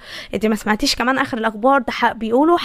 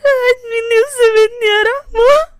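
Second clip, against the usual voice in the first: tearful, breaking into high sobs; crying; 7 semitones higher than usual. Acted. Shahd telling Rahma she still grieves her sister, sobbing. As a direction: grieve for the sister through tears. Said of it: "انتي بتعيطي؟".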